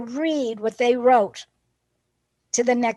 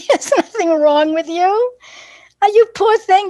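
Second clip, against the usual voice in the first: higher and louder, tender; comforting; 9 semitones higher, 6 dB louder than usual. Retold or imitated. Teacher comforting the struggling boy, emphatic. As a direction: reassure him with big sympathy.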